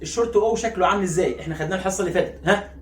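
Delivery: ordinary talk, nothing marked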